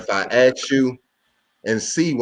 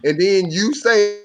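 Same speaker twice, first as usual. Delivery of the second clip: ordinary talk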